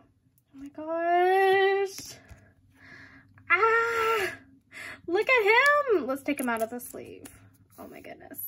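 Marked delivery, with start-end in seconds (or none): 0.0-2.2 high, very drawn-out squeal; thrilled squeal. Real, not acted. Fan first seeing the photo card, overwhelmed squeal. 3.5-4.3 high, held squeal of delight. Real, not acted. Fan seeing her card, squealing. First look at the photo card. 5.1-6.1 high, loud, drawn-out gush. Real, not acted. Fan showing her card to viewers, gushing.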